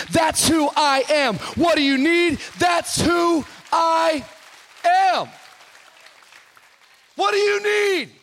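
loud, high, impassioned preaching; whooping sermon climax; full-force preaching. Acted. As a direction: preach it to the rafters.

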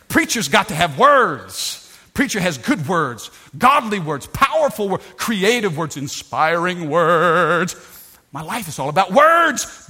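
loud, swelling declamation; impassioned preaching. Real, not acted. Preacher telling church how much he loves words, impassioned. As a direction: punch out each phrase like a fired-up preacher.

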